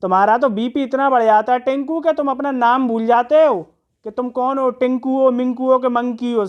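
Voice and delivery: ordinary talk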